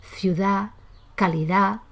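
"Ciudad, calidad" ending on open vowels, no final D; dropped final D. Shown as a demonstration. Teacher giving examples of lost D, "Ciudad, calidad" without final D. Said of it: "se pierde por completo".

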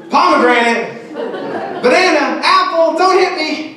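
high, loud fruit names, frantic; panicked fruit yelling. Retold or imitated. Student shouting fruit to dodge sword, frantic mock yell. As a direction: shout fruits in a terrified rush. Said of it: "standing there going".

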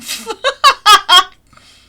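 loud high laugh; burst of laughter. Real, not acted. Laughs after dying in game. (크게 터지는 웃음)

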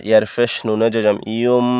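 ইয়ুম ending in a held m; N read as M. Shown as a demonstration. Teacher applying the rule, ইয়ুম closed on m. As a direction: hum the final m of ইয়ুম. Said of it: "নুনে জজমের জায়গাত মিমে জজমের উচ্চারণ".

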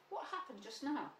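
plain reading voice

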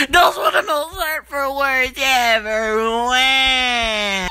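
slow, drawn-out tearful wail; exaggerated crying shout; hammed-up wailing. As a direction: bawl it out like a wounded cartoon character. Luigi wails at being insulted.

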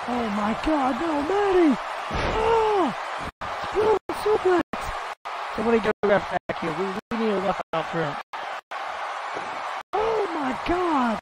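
hammed-up panic calling for a ref; frantic commentator. Commentator screaming for a referee during the brawl.

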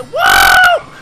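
loud high whoa; shouted whoa. Real, not acted. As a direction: yell it at the jump. Hitting a jump.

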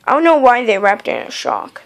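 ordinary talk, nothing marked

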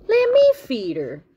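high, loud eager little character; excited storybook youngster; eager Froggy. Acted. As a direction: pipe up pleadingly, high and loud. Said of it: "said Froggy".

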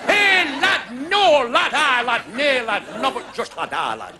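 hammed-up northern father scolding; imitating dad. Father scolding son over show business, mock gruff shout. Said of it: "my dad said".

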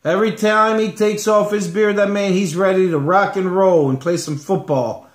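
usual speaking voice

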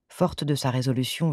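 ordinary delivery

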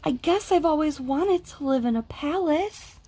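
a small bear voicing a wish; wondering little bear. A narrator's reading. Toy bear dreaming aloud of a palace, wistful.

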